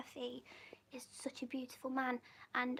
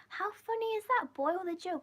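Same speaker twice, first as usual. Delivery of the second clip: high, bright amusement at the phrase; relishing a funny phrase; amused and giggly; 6 semitones higher, 4 dB louder than usual. Real, not acted. Laughing to viewers about New Zealand slang, amused.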